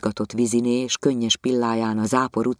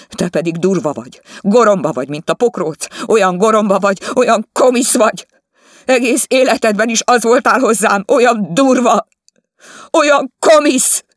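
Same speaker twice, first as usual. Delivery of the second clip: much higher and louder, building shriek; furious wife; 12 semitones higher, 11 dB louder than usual. Acted. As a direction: rage and cry at him. Wife screams at husband. (dühös feleség kiabál, egyre magasabban és hangosabban)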